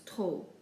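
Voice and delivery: TROP as a long "troh"; silent final P. Shown as a demonstration. Teacher giving the result of ignoring P, silent P. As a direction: say TROP with no P at the end. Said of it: "just ignore the P".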